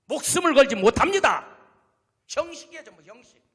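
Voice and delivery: preacher rebuking empty faith; preaching at full force. Acted. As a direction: build the sermon to a roar. Preacher builds to climax.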